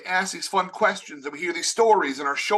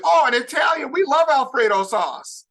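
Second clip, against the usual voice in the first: much higher and louder, thrilled shout; excited parents; 7 semitones higher, 5 dB louder than usual. Retold or imitated. Minnesota parents hearing about the Italian boyfriend, overjoyed. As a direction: beam it out, delighted. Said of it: "They said".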